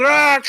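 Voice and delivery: a short high yelled Ah as he strikes the brick; mock karate yell. Friend retelling a Bloodsport brick-break scene, mock karate yell. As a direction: burst out a loud, high karate shout. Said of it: "so he just goes".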